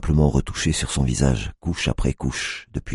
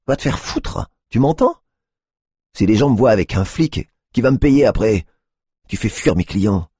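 higher, louder furious hooker; angry outburst; 8 semitones higher, 4 dB louder than usual. A narrator's reading. Tiffany chasing off the cop, angry and loud. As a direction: spit it at the cop in anger.

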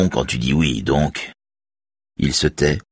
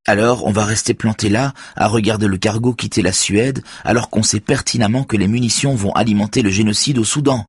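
higher, heated indignation; indignant; 8 semitones higher than usual. A narrator's reading. Saga protesting to her boss about the arms cargo, indignant. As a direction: protest at the injustice. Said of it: "s'indigne Saga".

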